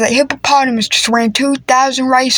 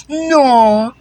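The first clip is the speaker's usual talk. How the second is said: usual speaking voice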